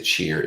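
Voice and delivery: "cheer" for chair, with an ee vowel; Baltimore "cheer". Shown as a demonstration. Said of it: "For chair, they say cheer".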